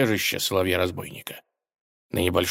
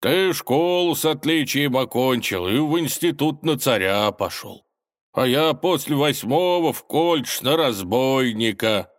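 higher, lilting reminiscence; nostalgic; 6 semitones higher, 3 dB louder than usual. A narrator's reading. Old schoolmates by the campfire, nostalgic. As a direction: look back wistfully on school days. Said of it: "ностальгически протянул Соловей".

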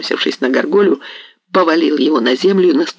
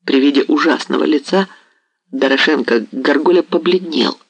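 usual speaking voice

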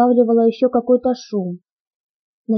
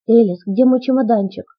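usual speaking voice

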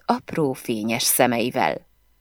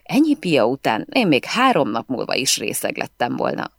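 ordinary delivery, nothing marked

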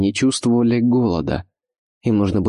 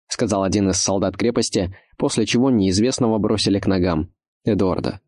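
plain reading voice

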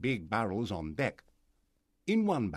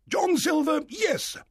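high, loud, blustering squire; angry high-pitched outburst; 7 dB louder, slower than usual. A narrator's reading. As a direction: shout it indignantly. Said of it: "said the squire angrily".

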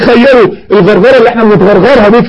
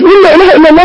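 rising urgent plea; 7 semitones higher, faster than usual. Retold or imitated. A passenger begging the dying man again, more urgent. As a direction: push harder each time.

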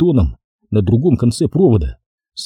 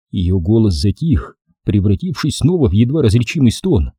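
plain reading voice

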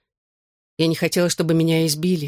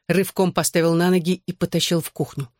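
ordinary delivery

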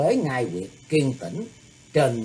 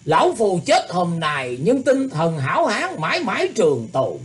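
booming voice; 7 semitones higher, 5 dB louder than usual. A narrator's reading. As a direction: boom it out like thunder. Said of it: "nói ồn ồn như sấm".

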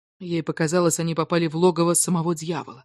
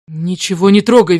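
louder, sharp order; firm command; 2 semitones higher, 8 dB louder than usual. A narrator's reading. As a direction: snap out the order firmly. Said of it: "велела она".

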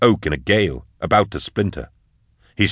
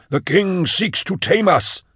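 high, slow otherworldly villain voice; villainous Magos; 11 semitones higher, slower than usual. Acted. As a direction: declaim high and slow, like something possessed. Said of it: "said Sark".

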